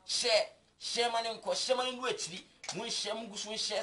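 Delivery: rousing appeal, each "share" stretched; urgent call. Real, not acted. Urging viewers to share.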